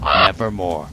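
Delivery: low, loud, ominous croak; raven's reply. Acted. The raven answers the speaker, low and ominous. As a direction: drop deep and toll the word like a bell. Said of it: "Quoth the raven".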